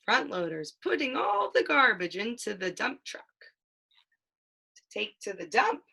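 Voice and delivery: lively picture-book read-aloud, playing up the sound words; reading to a child. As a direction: read it like a bedtime book for a toddler.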